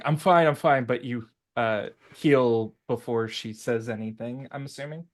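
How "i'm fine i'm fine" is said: louder, higher dismissive protest; brushing it off. Acted. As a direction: wave off help, a touch strained. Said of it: "she's like".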